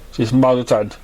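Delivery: ordinary delivery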